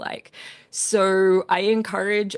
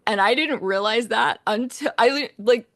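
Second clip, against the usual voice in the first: usual speaking voice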